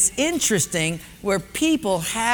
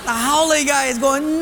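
usual speaking voice

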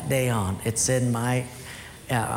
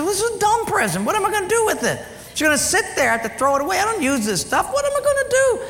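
scornful dad; faster than usual. Retold or imitated. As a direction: sneer at the gift like an annoyed father. Father ranting at gift.

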